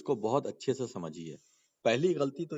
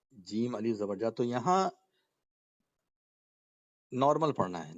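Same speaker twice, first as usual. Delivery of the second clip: ordinary talk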